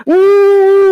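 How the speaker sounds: high, loud muffled hum with mouth shut. A narrator's reading. Turtle holding the stick in his mouth, loud high hum. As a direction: hum loudly through a closed mouth.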